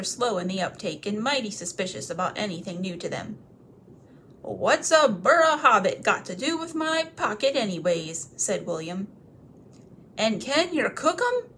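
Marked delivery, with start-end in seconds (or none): 4.4-8.3 loud, rough troll voice, suspicious. Acted. William suspicious of the hobbit, loud and rough. 10.2-11.5 much higher, eager troll voice; hungry troll. Acted. Tom asking the others if hobbits can be cooked, eager.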